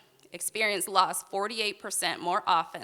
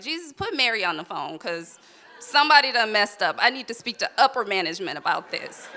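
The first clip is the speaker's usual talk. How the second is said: demanding a manager, louder in bursts; mock Karen; 8 dB louder than usual. To Jesus, demanding upper management over her loss, mock Karen. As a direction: complain like an entitled Karen, half-joking. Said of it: "I turned into a Karen".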